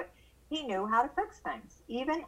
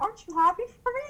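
high, fast and louder plea; eager son; 12 semitones higher, 4 dB louder, much faster than usual. Retold or imitated. Son begging mom to share his boat joy, eager and fast. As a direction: bounce with excitement, wanting approval.

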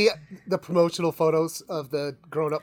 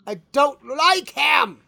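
put-on kid shouting refusal; 7 semitones higher, slower than usual. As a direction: yell it like a fed-up kid. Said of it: "And he goes".

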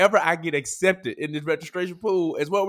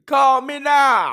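put-on slow, loud, high Jamaican-accent Call me now; hammed-up Jamaican psychic hotline pitch; mock Jamaican accent; 10 semitones higher, 7 dB louder, much slower than usual. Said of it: "That was a terrible Jamaican accent".

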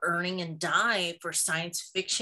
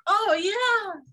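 mock surprised; 14 semitones higher, 6 dB louder than usual. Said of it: "And they were like".

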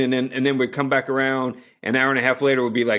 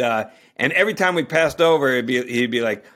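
ordinary delivery

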